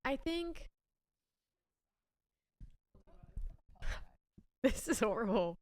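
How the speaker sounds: breaking down mid-sentence, half laughing; crying. Real, not acted. Admitting to her friend she feels blocked, tearful. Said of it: "I don't know why I'm crying".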